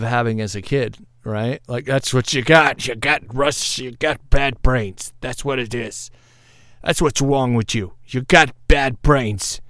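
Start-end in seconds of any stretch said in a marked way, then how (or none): 1.8-9.6 a put-on New York accent, lightly done; mock tough New Yorker scolding a kid. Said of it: "don't know what that accent is".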